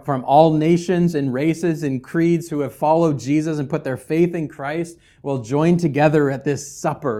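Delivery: ordinary talk, nothing marked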